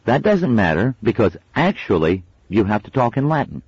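put-on a know-it-all kid correcting friends; child voice. A narrator's reading. Child Wensleydale explaining.

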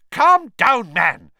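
loud, high-pitched order; barking. A narrator's reading. Minister to a hysterical professor, barking. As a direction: bark at him to settle down. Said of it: "Fudge barked".

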